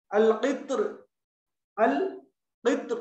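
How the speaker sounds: अल-क़ित्र with a thin, light r at the end; thin final raa. Shown as a demonstration. Teacher drilling thin raa, अल-क़ित्र with light final r. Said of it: "बारीक पढ़ना है".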